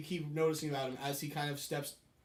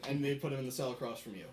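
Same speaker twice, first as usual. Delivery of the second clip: ordinary delivery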